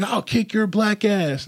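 high, drawn-out mock threat; imitated threat. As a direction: mimic an irate driver threatening a fight. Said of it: "talking hella shit".

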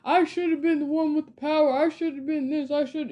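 a spoiled rival whining that the power should have been his; whiny Draco Malfoy. Retold or imitated. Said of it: "very much giving Draco Malfoy".

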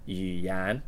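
语 low, then 言 rising; third then second tone. Shown as a demonstration. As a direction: demonstrate 语言, low tone into a rise. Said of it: "the third tone and the second tone".

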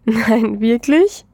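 thrilled, can't believe it; delighted disbelief. A narrator's reading. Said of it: "Sie lacht zurück". (freudig ungläubig, höher)

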